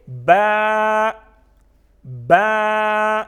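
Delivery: با loud, vowel held long and level; stretched letter. Shown as a demonstration. Teacher shows what we call it, با stretched long. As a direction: draw با out steadily. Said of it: "اس کو ہم کہیں گے".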